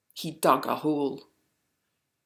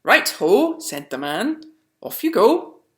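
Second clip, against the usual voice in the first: hearty call; 6 semitones higher, 9 dB louder than usual. A narrator's reading. Man sends them off.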